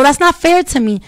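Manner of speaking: usual speaking voice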